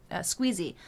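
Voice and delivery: usual speaking voice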